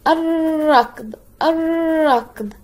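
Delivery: "ar-rakd" for الركض, trill drawn out; r pronounced twice. Shown as a demonstration. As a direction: stretch the rolled r in الركض, dropping the l. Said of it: "نطق مرتين".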